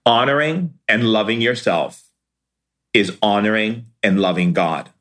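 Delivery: honoring and loving yourself spaced out with long pauses. Shown as a demonstration. Teacher repeating the key line slowly for listeners. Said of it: "I'm gonna say it slower".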